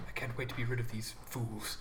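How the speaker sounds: low, very soft muttering; under his breath. Acted. Contestant grumbling to himself about rivals, low mutter. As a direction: mutter it privately, pitched well down. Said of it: "he says, under his breath".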